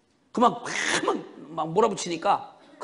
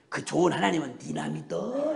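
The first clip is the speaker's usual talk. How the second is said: softly drawled Chungcheong brush-off; understated Chungcheong nonchalance, softer and lower; sly Chungcheong dialect; 5 semitones lower, 5 dB quieter than usual. Retold or imitated. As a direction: imitate a wily, unbothered Chungcheong mother. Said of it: "충청도 말로 느물느물해".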